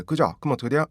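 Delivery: ordinary delivery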